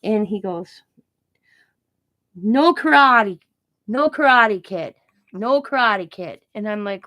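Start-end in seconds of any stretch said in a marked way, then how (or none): 2.4-6.4 slow, emphatic mock prohibition; punchy, drawn-out doctor mimicry; doctor impression. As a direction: imitate the doctor laying down rules, stretching each one. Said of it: "and that's how he said it".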